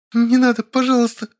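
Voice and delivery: high, tearful begging; sobbing plea. A narrator's reading. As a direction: plead through tears. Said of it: "всхлипывая и сбиваясь на плач". (мольба сквозь всхлипы)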